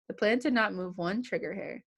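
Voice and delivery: ordinary delivery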